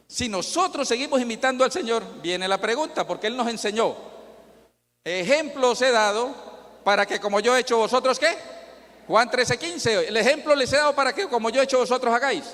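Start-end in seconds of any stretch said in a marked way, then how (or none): 5.0-12.4 higher and louder, driving the verse home; fervent preaching. Acted. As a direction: proclaim the verse like a call from the pulpit. Quoting scripture with force. (predicación fervorosa y más aguda)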